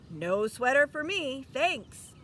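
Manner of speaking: a touch of a hearty loud no; gruff refusal. Acted. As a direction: brush the offer off loudly. Grumpy neighbor declining.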